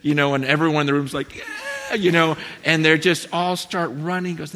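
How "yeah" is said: a sudden very high cheer; cheering crowd. Retold or imitated. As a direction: cheer like the whole room. Room cheers at running story.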